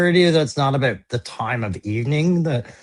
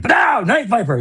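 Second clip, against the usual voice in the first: higher-pitched, excited big reveal; dramatic reveal; 8 semitones higher than usual. Acted. Turning the figure around for a big reveal, dramatic and high. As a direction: announce it like a surprise reveal. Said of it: "you're like".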